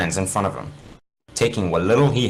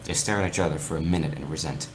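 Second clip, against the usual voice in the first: usual speaking voice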